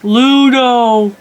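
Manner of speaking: loud, high call across a distance. Retold or imitated. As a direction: call out to the rocks. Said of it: "he calls out for the rocks and he goes".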